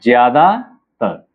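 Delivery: slow ज्यादातर, split into ज्यादा and तर; drawn-out dictation of ज्यादा... तर; syllable by syllable. Shown as a demonstration. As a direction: say ज्यादातर in two parts, pausing before तर. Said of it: "ज्यादातर कैसे लिखेंगे?".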